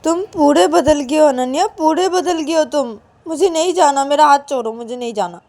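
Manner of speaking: put-on high, sharp scolding girlfriend voice; angry scolding. Payal scolds Ananya for not opening the door, angry. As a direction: tell him off for forgetting her.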